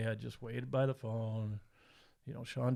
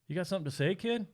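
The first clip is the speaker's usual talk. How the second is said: higher-pitched retold challenge to a kid; challenging deputy; 7 semitones higher than usual. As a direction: imitate a cop's pointed question, voice rising. Deputy challenges the son in story.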